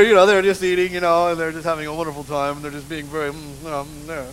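ordinary talk

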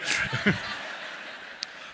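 airless wheezing laugh; breathless laugh. Real, not acted.

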